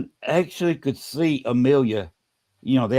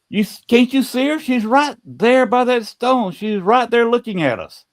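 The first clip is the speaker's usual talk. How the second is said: imitated urgent insistence, held high throughout; high-pitched retold plea to be believed; insistent pleading; 8 semitones higher than usual. Woman urging the others to see the ghost by the stone, insistent.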